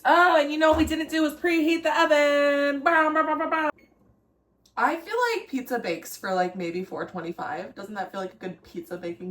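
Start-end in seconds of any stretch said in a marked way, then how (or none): 2.8-3.7 loud bow-bow sound effect; imitated noise. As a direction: shout bow bow like a cartoon. Sound effect noise while cooking.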